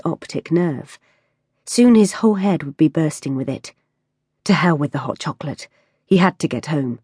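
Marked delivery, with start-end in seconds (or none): none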